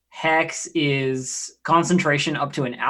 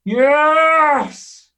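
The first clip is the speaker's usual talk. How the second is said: high, stretched-out celebration; real elated cry; triumphant cheer; 12 semitones higher, 4 dB louder than usual. Real, not acted. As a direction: cheer a successful hit, long and high. Celebrates a hit.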